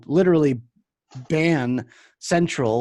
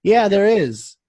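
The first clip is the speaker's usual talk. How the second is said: mock insistent customer; 6 semitones higher, 5 dB louder, slower than usual. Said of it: "He's like".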